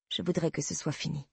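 softly confessed, weary wish; quiet admission. A narrator's reading. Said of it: "avouai-je".

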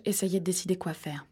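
ordinary delivery, nothing marked

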